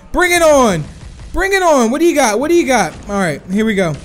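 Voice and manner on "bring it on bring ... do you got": loud, high-pitched challenge; fired-up taunting. Real, not acted. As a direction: egg the enemy on mid-fight. Taunting opponents during live fight.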